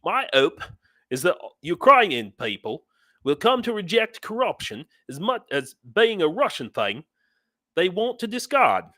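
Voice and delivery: high, sing-song mock Australian accent. Host reading a viewer's comment aloud in Australian accent. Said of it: "How was that for my Australian accent?".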